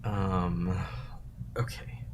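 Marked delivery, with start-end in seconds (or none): 1.6-2.0 soft unvoiced muttering to self; whispered mutter. Real, not acted. Streamer quietly scrolling chat, whispering to himself. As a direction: mutter it under your breath.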